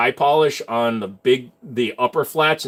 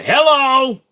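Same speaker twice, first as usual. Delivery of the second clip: exaggerated hello; 11 semitones higher, 7 dB louder than usual. Calling out in mock surprise.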